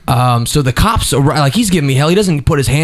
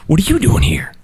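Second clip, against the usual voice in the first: hissed under the breath at the intruder; tense whisper. Retold or imitated. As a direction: whisper it like a threat. Angry ex confronting him.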